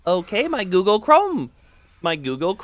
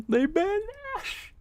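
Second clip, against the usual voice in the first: much higher, softer and slower, put-on voice; 13 semitones higher, 7 dB quieter than usual. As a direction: float it up high and slow. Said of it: "voice play".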